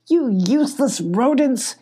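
a gruff leader yelling at his lackeys; angry yell. A narrator's reading. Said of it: "yelled Gruff".

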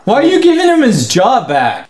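high-pitched incredulous protest; indignant. Real, not acted. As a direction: demand an answer in disbelief. Ranting at the dog's reinstatement.

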